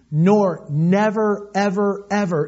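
"nor never, ever, ever" drawn out slowly, each word leaned on; slow stress on never ever ever. Shown as a demonstration. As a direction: hammer the repeated "ever" slowly for emphasis. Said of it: "emphasized three times".

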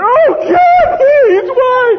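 very high, loud wail to God; on his knees, wailing at heaven; anguished cry. Retold or imitated. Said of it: "I'm really in there".

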